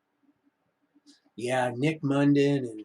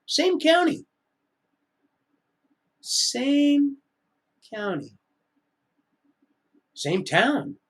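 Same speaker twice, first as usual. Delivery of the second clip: same county cried very high; much higher cry; 12 semitones higher, 3 dB louder, much slower than usual. Real, not acted. Vlogger repeating the coincidence, voice soaring high. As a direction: let same county break upward.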